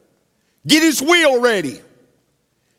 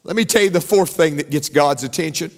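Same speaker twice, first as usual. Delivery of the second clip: usual speaking voice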